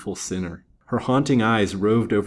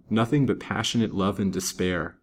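ordinary delivery

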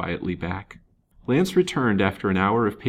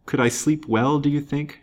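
higher-pitched, wounded reproach; reproachful, pitched up with feeling; passionate reproach; 7 semitones higher than usual. A narrator's reading. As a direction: throw it back as a reproachful question. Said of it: "in a voice full of passionate reproach".

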